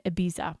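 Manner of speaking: "Ibiza" said the American way, ih-BEE-zuh, with a plain z sound. Real, not acted. Travel vlogger to viewers, owning her Americanized "Ibiza". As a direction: say "Ibiza" as an American would, not the Spanish ee-BEE-tha. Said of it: "that's how I say it in my American accent".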